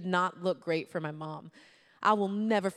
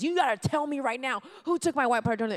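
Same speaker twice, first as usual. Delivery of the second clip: mild retold yell, higher-pitched; yelling at friends; 6 semitones higher, 4 dB louder than usual. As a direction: demand an answer, playing up the outrage. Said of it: "I am yelling at my friends".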